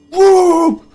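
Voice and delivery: very high, loud yelping bark; sudden shrill woof at the window; dog bark. Retold or imitated. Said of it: "She's like".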